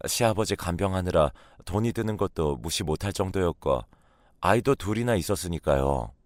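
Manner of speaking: usual speaking voice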